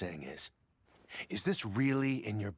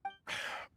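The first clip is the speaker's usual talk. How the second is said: resigned sigh; 7 dB quieter than usual. Acted. Merchant gives in.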